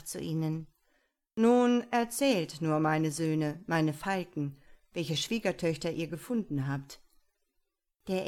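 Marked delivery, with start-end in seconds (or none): 1.3-7.0 a father summoning his sons with weight; the father speaking. Acted.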